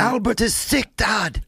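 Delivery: high, put-on old-timey kid; old movie child voice. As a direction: plead like a stagey film child. Old-fashioned movie line imitation.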